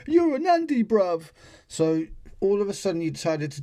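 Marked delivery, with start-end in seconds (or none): 0.1-1.3 much higher, louder mock lad; mock taunt. Vlogger mocking the man who accused a stranger, high mock taunt. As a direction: squeak the taunt out in mockery. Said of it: "and he says".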